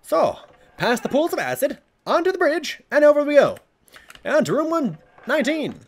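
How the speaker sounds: high, sing-song tour guide; playful narration. Pretend. Guiding the viewer through the level, high and playful. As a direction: announce the route like a guide.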